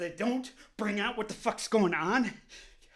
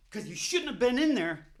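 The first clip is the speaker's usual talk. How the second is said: ordinary talk, nothing marked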